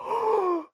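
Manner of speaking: long, high intake of breath; imitated amazed gasp. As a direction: gasp in mock astonishment.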